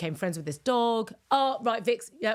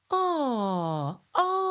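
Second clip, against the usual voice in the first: long falling "ah", a sigh with a catch of crying; heart-opening ah; 3 semitones higher than usual. Shown as a demonstration. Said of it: "And it goes".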